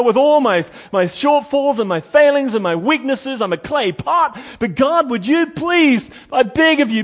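impassioned pleading prayer; fervent prayer. Pretend. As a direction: beg God with all your heart. Acted fervent morning prayer.

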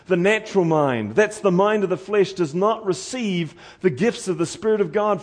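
normal talking voice

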